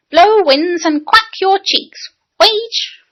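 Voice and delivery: "quack" and "Wage" with W for R, high and slow; boy declaiming, every R a W; high, childish "Wage" for rage. A narrator's reading. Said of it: "Do pray try to say rage".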